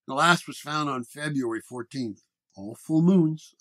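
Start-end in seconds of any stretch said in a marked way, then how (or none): 2.5-3.5 flat, slow, even statement; deadpan slow delivery of a grim fact; flatly. A narrator's reading. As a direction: lay it out with no expression. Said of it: "I said flatly".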